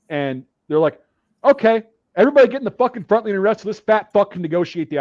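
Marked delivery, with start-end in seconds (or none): 1.4-5.0 raised, higher voice ordering the group; imitated instructor bark. Instructors berating the platoon over one struggling recruit, imitated bark. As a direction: call it out like an irritated instructor.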